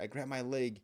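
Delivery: ordinary delivery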